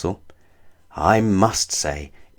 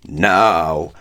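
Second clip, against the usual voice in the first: thundering giant; 3 semitones lower than usual. Acted. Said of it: "thundered Wizard Winterbottom".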